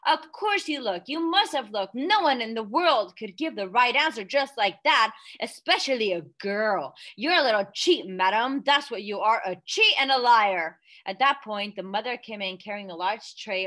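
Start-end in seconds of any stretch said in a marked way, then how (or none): 0.1-9.4 angry rant. Acted. Father ranting at daughter. 9.4-10.8 angry rant. Acted. Father ranting at daughter.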